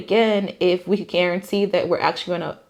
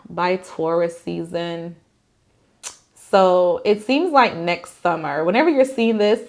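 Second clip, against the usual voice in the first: usual speaking voice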